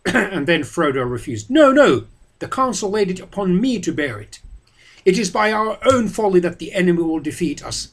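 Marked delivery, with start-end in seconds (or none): 1.5-7.9 loud, high angry outcry; crying out. A narrator's reading. Boromir crying out at Frodo, frustrated. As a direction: cry out in frustration. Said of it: "cried Boromir".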